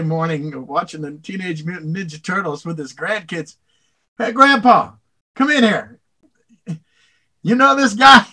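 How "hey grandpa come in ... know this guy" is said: put-on high, loud kid calling out; eager child yelling across the house; excited grandkid. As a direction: shout to grandpa from the TV room.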